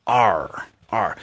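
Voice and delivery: low growled "Arr, arr"; low, mild growl on "Arr"; lion imitation. Shown as a demonstration. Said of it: "imitar a un león".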